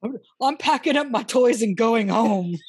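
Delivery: loud laughing mock sulk; childish threat. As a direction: stomp off like a sulking kid. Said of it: "childish sulking threat".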